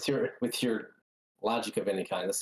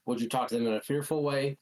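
ordinary talk, nothing marked

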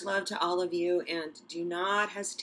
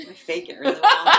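laughing; 11 semitones higher, 15 dB louder than usual. Real, not acted. Laughing at own joke.

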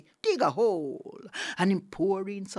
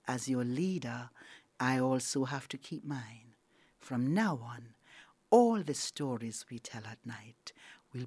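solemn Tiger; 7 semitones lower, 5 dB quieter than usual. Acted. Tiger the leader granting Anansi his reward, grave and low. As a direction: pronounce it like a king, sinking to a hush.

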